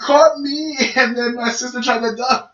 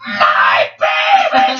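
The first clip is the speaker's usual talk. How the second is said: long, drawn-out mock wail; horrified mother's cry; 4 dB louder, much slower than usual. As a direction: wail it slowly like an alarmed mother. Mother's horrified cry on coming home.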